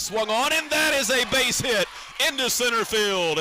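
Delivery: radio announcer calling a base hit; excited hit call. Real, not acted.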